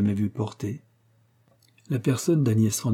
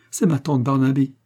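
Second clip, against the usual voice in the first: a startled, higher-pitched cry of dread; terrified cry; 4 semitones higher, 4 dB louder than usual. A narrator's reading. Girl warns her aunt who is arriving, alarmed. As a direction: exclaim it in mild alarm. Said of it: "avec un accent de terreur".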